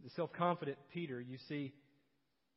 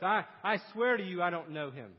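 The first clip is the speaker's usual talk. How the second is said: Peter's oath, louder and emphatic; sworn denial; 9 dB louder than usual. Acted. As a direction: swear it forcefully, pushing the denial out. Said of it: "He denies it with an oath".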